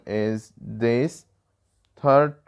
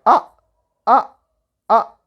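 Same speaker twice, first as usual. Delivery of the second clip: short "ah" falling from high, like surprise; fourth tone; 9 semitones higher, 7 dB louder, faster than usual. Shown as a demonstration. Teacher demonstrates Chinese fourth tone, "ah" short, high, falling. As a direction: start "ah" high and drop it abruptly. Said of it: "is like a surprise manner".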